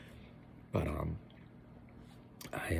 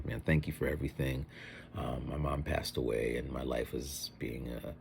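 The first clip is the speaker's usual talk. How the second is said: mock heartfelt speech; 5 semitones lower, 9 dB quieter than usual. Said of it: "No, I didn't say all that".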